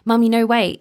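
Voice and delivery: put-on high, urgent little-boy plea; mock toddler calling out loud; imitating pleading toddler. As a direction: beg mummy to stay like a toddler. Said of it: "he'd be like".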